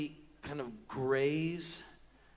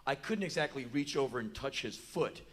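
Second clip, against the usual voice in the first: normal talking voice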